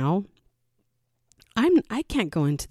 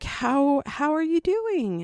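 polite greeter; 9 semitones higher, slower than usual. Retold or imitated. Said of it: "People would be like".

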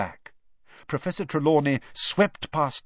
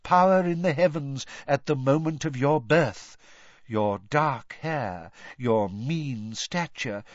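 mystical seer voice; 6 semitones higher than usual. A narrator's reading. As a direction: intone it grandly like an oracle.

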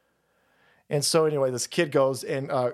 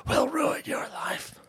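mock menace; 9 semitones higher, 4 dB quieter, slower than usual. Repeats the threat, mock voice.

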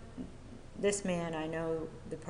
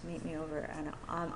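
normal talking voice

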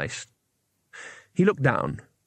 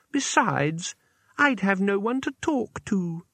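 much higher, slow, sugary threat; a deranged girl pretending politeness; sweetly menacing girl; 7 semitones higher, slower than usual. A narrator's reading. Isabel stopping the two visitors, high, slow and sugary.

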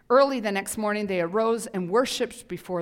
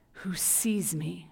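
hushed whisper; 3 semitones lower, 6 dB quieter, much slower than usual. Acted. Quoting Hagar softly.

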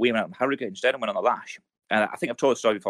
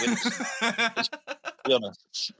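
laughing; 10 semitones higher, much slower than usual. Real, not acted. Laughing mid-sentence.

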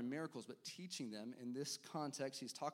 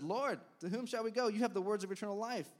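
higher, louder fervent plea; earnest Peter; 6 semitones higher, 6 dB louder than usual. A narrator's reading. Simon Peter answering Jesus, fervent and raised. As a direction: pour out devotion as Peter. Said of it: "he said".

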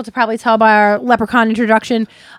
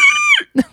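banshee shriek. Acted. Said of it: "it sounds like an eagle".

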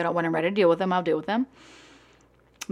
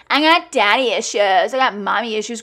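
mocking whiny voice; 2 semitones higher, 7 dB louder than usual. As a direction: sneer at the label in a put-on voice. Mocking people who blame parents.

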